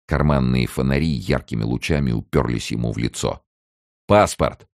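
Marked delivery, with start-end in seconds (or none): 4.1-4.7 raised, higher one-word order; curt demand. A narrator's reading. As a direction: demand papers like a rough gendarme. Gendarme demands papers from captive.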